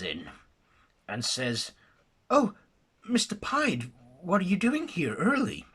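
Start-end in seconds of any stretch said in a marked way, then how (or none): 2.3-5.6 higher, bright surprise; a secretary caught off guard; surprised secretary. Acted. Missus Hatcher greeting her boss, surprised.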